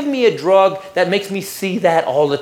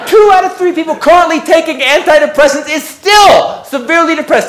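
high, loud outraged repeat; incredulous shout; 7 semitones higher, 4 dB louder than usual. Acted. Comic repeating a statistic that seems wrong, incredulous shouting. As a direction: shout it in utter disbelief, hitting STILL hard.

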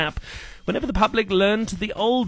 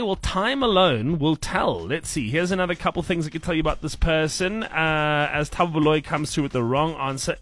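ordinary talk

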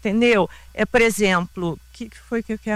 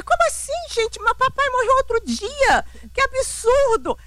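offended outrage. Retold or imitated. Daughter protests to family about her late father, offended outrage. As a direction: replay a scandalized protest, pitch soaring. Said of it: "eu tava ofendidíssima".

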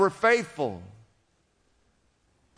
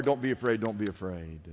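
softly reassuring; 11 semitones lower, 7 dB quieter than usual. Acted. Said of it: "Daniel is cheering you on".

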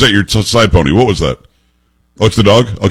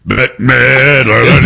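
drawn out; much slower than usual. Acted. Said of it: "drawn-out joke name".

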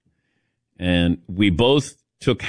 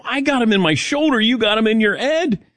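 pitched way up, joking indignation; high mock protest; 9 semitones higher than usual. Teasing a fellow patient with a high, jokey protest. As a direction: squeak it up in playful protest. Teasing Dan about the shots.

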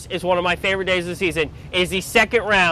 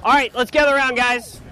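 raised voice, calling out; calling a crowd; 6 semitones higher than usual. Real, not acted. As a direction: gather the crew from across the tree. Calling crew to gather.

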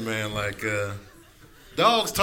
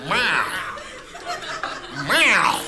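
cat meow; 6 semitones higher than usual. Acted. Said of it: "cats are yelling at you".

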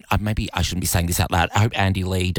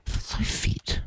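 whisper; 5 dB quieter than usual. Real, not acted. Aside about the fit stretch lady.

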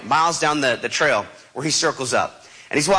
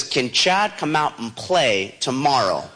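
slow deliberate read; 3 semitones lower, slower than usual. Retold or imitated. Son reading the odd email from dad's screen, slow and deliberate. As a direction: read it out slowly, word by word.

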